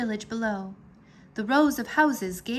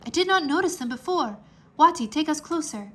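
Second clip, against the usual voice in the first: higher, louder and fast; excited urging; 6 semitones higher, 5 dB louder than usual. A narrator's reading. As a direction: urge the dragon closer eagerly. Heru excited at discovery.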